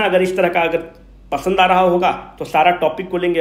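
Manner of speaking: ordinary talk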